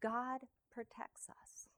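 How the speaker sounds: whispered in awe; awed whisper. Real, not acted. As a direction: breathe it out as a quiet wonder. Awed conclusion to miracle story.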